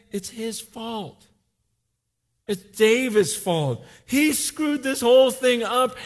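loud accusing crowd; angry blaming men. Retold or imitated. Soldiers turning on David, angry. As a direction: point the finger hard.

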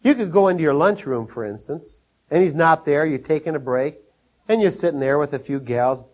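normal talking voice

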